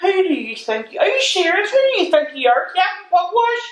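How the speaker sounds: can't believe what you just heard, laughter creeping in; incredulous. Acted. Friend scoffing at the coy name, incredulous.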